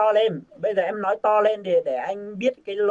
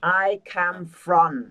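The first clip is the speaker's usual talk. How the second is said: "I come from" said slowly, word by word; slow, careful teaching read; slow model phrase; 3 dB louder, much slower than usual. Shown as a demonstration. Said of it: "bắt đầu ở cuối này nhé". (đọc mẫu chậm rãi từng từ)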